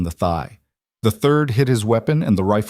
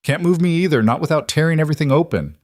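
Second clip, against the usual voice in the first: higher, quickened voice of a hurt patient; pained protest; 7 semitones higher, faster than usual. A narrator's reading. Injured patient objects to being moved, pained protest. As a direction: protest through mild pain, pitched up. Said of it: "Nash coughed, grimacing".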